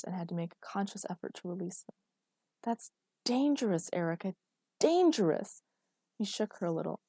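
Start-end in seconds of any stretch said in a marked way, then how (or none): 2.6-5.6 insistent warning, second hit higher and louder; urgent, high-pitched reprimand; alarmed warning. A narrator's reading. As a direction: scold with mounting alarm. Alarmed scolding of her.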